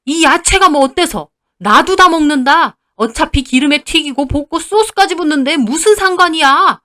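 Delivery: high-pitched, loud angry ranting; raging father-in-law. A narrator's reading. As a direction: rant indignantly at the daughter-in-law. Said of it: "아버님이 길길이 날뛰니". (길길이 날뛰며 고함치는 시아버지)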